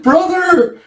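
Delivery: high, loud drawn-out cry; hammed-up desperate call; mock wail. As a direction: wail for your brother to save you. [name] wails for his brother.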